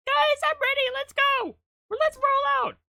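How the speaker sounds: very high, loud hype shout; hyped call. Real, not acted. Streamer hypes up the chat, high loud shout. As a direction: fire the chat up.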